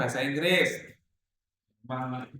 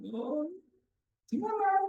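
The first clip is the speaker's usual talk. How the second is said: curious parent; 4 dB quieter than usual. Retold or imitated. Teacher acts out parents chatting at school.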